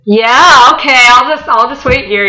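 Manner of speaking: feigned nonchalance. Retold or imitated. Acting out her awkward waiting reply.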